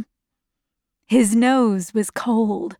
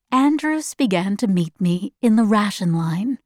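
plain reading voice

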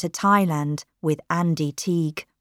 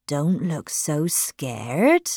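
gently; 2 semitones lower, much slower than usual. Acted. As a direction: soothe her slowly, falsely kind. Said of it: "he said gently".

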